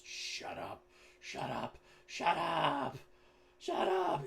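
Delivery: tantrum shouts building to full volume; childish yelling. Acted. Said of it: "he yells back from the darkness".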